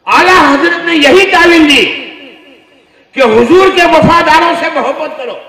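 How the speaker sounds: very loud, high pulpit climax; fiery preaching. Acted. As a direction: cry it out to the whole gathering. Preaching at full force. (ज़ोरदार चीखती तक़रीर)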